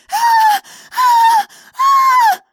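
high piercing screams, very loud; screaming woman. Acted. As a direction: shriek in terror. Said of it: "She kept screaming".

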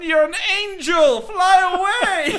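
very high, slow, drawn-out wail; mock-mournful falsetto send-off; high wailing Broken Matt Hardy. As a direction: stretch it out like a grieving farewell. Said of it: "and he goes".

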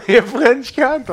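loud, high, drawn-out joking insult; mock curse. Friends joke about the French insult, mock shouting. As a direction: hurl it slowly and loudly, in fun.

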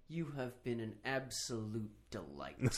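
slow, quiet, sweetly menacing; overly polite with a threat underneath; fake polite. Shown as a demonstration. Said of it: "my favorite thing to say at the end".

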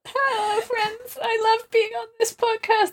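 mock high greeting. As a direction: chirp it like a fake host.

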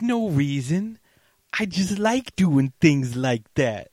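hint of a smug killer; mild menacing villain. Retelling Luther's taunt, lightly sinister.

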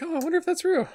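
a hopeful high voice gazing up at the sky; dreamy Glimmy. Retold or imitated. Co-host voicing Glimmy watching the core fighter overhead, high wondering voice.

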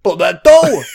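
loud, high mock Italian; fake Italian accent. Co-host echoes the joke name, fake Italian accent. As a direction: boom it out in cartoon Italian. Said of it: "sounded like it was pretending to be Italian".

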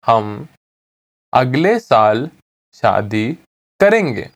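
slow, word by word with clear pauses; slowly. Shown as a demonstration. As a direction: split the sentence up for a learner. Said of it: "I'll say it again slowly".

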